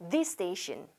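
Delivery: "This station" linked with one shared s; single s. Shown as a demonstration. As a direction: repeat "This station" softly as one word, one s. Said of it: "한 번만 발음해 볼게요".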